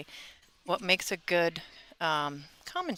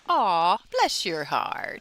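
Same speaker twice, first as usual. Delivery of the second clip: slow, sugary Southern "bless your heart," much louder on "Aw"; Southern fake sweetness; 2 semitones higher, 8 dB louder, much slower than usual. Host explaining the Southern put-down, in a syrupy Alabama drawl. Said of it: "they'll say".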